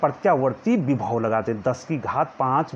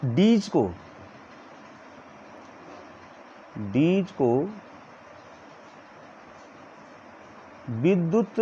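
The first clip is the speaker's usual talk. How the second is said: word by word with long pauses; slow dictation; 5 dB quieter, much slower than usual. Shown as a demonstration. Teacher dictating notes to students, slowly word by word. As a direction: dictate each word slowly so they can write. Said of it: "मैं धीरे धीरे बोल रहा हूँ".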